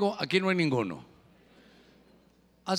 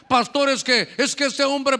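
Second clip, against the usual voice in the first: higher, louder imitation of an excuse-maker; pleading congregant; 5 semitones higher, 6 dB louder than usual. Woman explains herself to her pastor, insistent mock pleading. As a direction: blurt out a protest in a congregant's voice.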